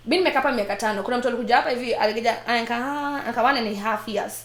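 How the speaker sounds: ordinary talk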